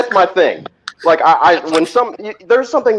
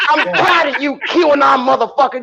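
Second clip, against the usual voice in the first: mock rant; 8 semitones higher than usual.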